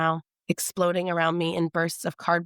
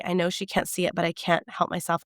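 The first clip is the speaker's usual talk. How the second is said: ordinary delivery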